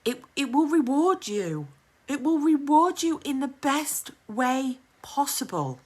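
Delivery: ordinary delivery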